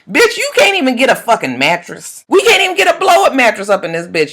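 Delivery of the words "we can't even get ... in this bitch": ordinary talk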